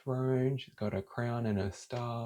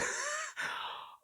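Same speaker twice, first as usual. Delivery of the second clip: high squealing laugh; 7 dB quieter than usual. Real, not acted. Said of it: "Sorry, I have to".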